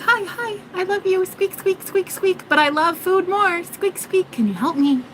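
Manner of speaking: high squeaky mouse voice, sweetly begging. Retold or imitated. The mouse greeting her and begging for food, in a high squeaky mouse voice. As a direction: play the hungry mouse asking for food. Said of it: "and he's like".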